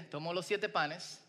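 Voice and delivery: ordinary talk, nothing marked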